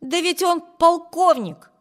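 high, loud and drawn out; emphatic exclamation. A narrator's reading. Wibert insisting to Amy about the stranger, loud exclamation. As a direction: exclaim it as clinching proof. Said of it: "воскликнул Виберт".

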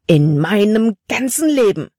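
angry outburst. Acted. Caleb scolding Libby after the bear, angry. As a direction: burst out in anger. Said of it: "Sein Gesicht war zornerfüllt".

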